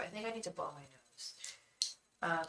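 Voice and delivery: normal talking voice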